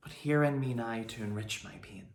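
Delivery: soft, halting verse fading almost to a whisper; subdued Shakespeare monologue. Acted. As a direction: let the speech die away softly, with long pauses.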